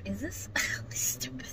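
hushed, voiceless mutter of disbelief; retold disbelieving whisper; whispered aside. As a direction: whisper it under your breath to a bystander. Retold aside to a nearby driver.

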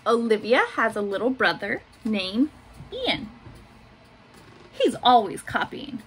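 put-on gentle picture-book reading for little kids; reading to children. A narrator's reading. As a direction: read it like story time in class. Teacher reads picture book aloud.

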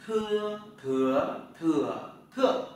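"thưa" with a puff of air, through four tones, loud; aspirated thưa drill. Shown as a demonstration. Teacher showing students the aspirated "thưa". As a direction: breathe out hard on "thưa" each tone. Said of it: "Tờ là chữ thờ nhé".

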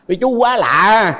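slow amused quote with a big laugh; laughing reply. Retold or imitated. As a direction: re-enact laughing it off. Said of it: "Tôi cười vậy".

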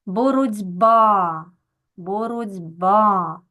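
"боротьба" slowly, final А long and stressed; stress on last syllable. Shown as a demonstration. Teacher marks the stressed vowel for pupils, stress on last syllable. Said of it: "наголос на".

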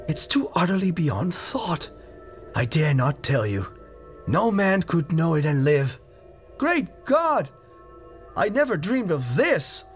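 high, rising desperate cries; horrified and hopeless; fear and despair. A narrator's reading. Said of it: "now apparently tinged with despair".

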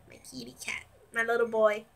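soft unvoiced whisper to a pet; whispering to cat. Real, not acted. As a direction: whisper lovingly to the kitty.

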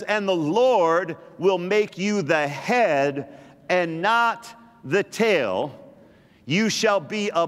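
very slow, every word weighed; slow scripture reading. Shown as a demonstration. Preacher reading the verse to the congregation, slow and deliberate. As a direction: read the verse slowly and deliberately. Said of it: "And it says".

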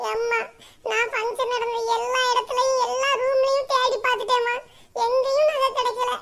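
put-on high girlish voice, slowing sadly; dejected daughter. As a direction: report the bad news tearfully. Daughter returns crying.

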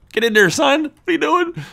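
high, loud exasperated outburst; exasperated shout. Real, not acted. Player urging the dawdler to get in there, exasperated high shout. Scolding a stalling game target.

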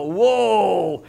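long drawn-out impressed whoa; acted excited awe. Guys hearing about a powerful truck, acted awe. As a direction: marvel in mock awe, stretching it out. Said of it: "that kind of excites us".

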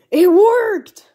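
high, loud triumphant cry; excited. Acted. Two friends find the body swap worked, excited shout.

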